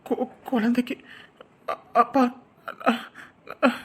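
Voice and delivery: halting, broken syllables with long gaps; faltering, stuttered hesitation; nervous stammering. Acted. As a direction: struggle to get a confession out. Son stammers under questioning.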